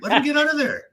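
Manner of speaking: incredulous, exasperated plea. Real, not acted. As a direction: beg them to just let him go. Exasperated at plane story.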